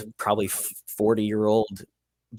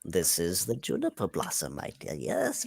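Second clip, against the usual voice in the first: soft, lower, tender character voice; fond parent; 4 semitones lower, 7 dB quieter than usual. Acted. Halfling parent telling Grendel about the new brew, tender. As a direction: answer gently with quiet pride.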